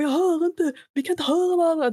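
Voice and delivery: hammed-up annoyed neighbour; irritated older man. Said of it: "irriterad äldre vit man".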